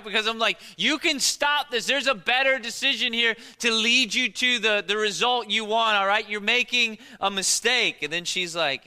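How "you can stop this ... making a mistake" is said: higher and louder, pleading with the character; yelling at the TV. Retold or imitated. Husband yelling at a TV character's mistake, loud. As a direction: yell at the screen like a coach. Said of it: "I'm, like, yelling at it".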